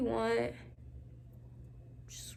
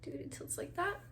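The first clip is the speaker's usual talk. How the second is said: whispering; 5 semitones higher, 10 dB quieter than usual. Real, not acted. Whispered instruction.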